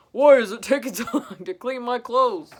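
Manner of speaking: loud, laughing grumpy complaint; grumpy Santa. Acted. Santa complaining about his clothes, loud and grumpy. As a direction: grumble loudly, breaking into a laugh. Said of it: "Santa's getting grumpy".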